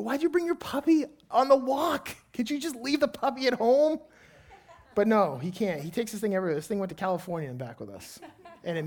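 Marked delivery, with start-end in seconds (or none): 0.0-4.0 put-on higher, louder exasperated question to a child; father scolding his son about bringing the puppy, exasperated; exasperated dad. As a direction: scold the kid in disbelief.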